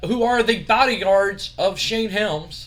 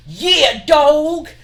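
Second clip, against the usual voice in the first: higher, louder mock cheer; hype cheer; 9 semitones higher, 9 dB louder than usual. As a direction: throw it up like a celebration. Scotty Too Hotty cheers.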